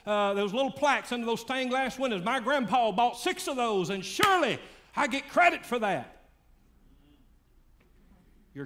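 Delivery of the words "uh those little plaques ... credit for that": building from plain into high, loud bragging; smug churchgoer boasting. Acted. Preacher voicing a listener bragging of family donations, building loud. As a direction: brag about family pews, getting higher and louder. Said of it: "You say".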